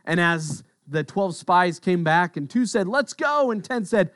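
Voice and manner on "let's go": eager call. Retold or imitated. Spies report, eager two.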